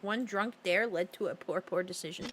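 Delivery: usual speaking voice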